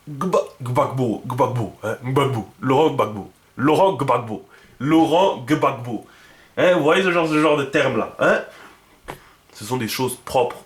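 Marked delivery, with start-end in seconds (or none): 0.1-2.5 gbagbo with a double gb, lips and throat closing together; slow, careful gba, gbagbo; gb sound. Shown as a demonstration. Teacher drilling the gb sound, slow and careful. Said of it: "d'autres termes comme gbe". 2.6-6.1 Laurent Gbagbo, gb closed at lips and throat at once; Gbagbo name. Shown as a demonstration. Teacher repeating Laurent Gbagbo for students, slowing down. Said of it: "d'autres termes comme gbe".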